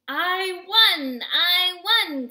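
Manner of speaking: loud, high bragging shout; triumphant yelp. A narrator's reading. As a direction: yelp it like a gloating winner. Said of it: "Coyote yelped".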